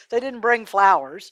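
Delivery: ordinary delivery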